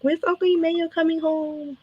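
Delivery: put-on high, soft little-girl question; Sharice. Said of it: "she'll be like".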